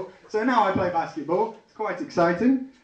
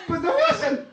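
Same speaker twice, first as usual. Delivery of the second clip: gleeful punchline; 3 dB louder than usual. Acted. Comic delighting in the hoax bomb, high gleeful squeak.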